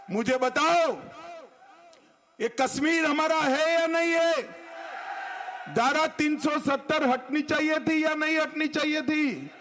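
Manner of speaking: booming political rally speech. Real, not acted. Asking rally crowd loudly.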